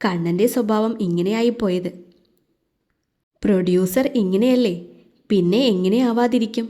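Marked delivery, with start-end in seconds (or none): none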